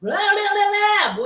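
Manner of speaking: high, loud blaring shout; loud inner voices. Shown as a demonstration. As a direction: blare it out to drown the other voice. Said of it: "voice number one or two is like this".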